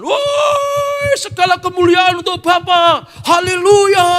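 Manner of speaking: high, loud ecstatic shouting; wild angelic cheer, voice leaping up; cheering angels. Acted. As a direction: whoop with heavenly joy. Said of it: "para malaikat bertepuk tangan".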